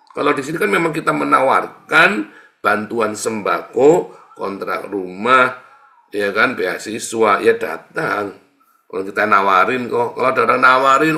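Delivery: loud, slowed, stressed sermon points; emphatic preaching. Acted. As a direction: lean hard on each offer. Preacher scolds refusing help.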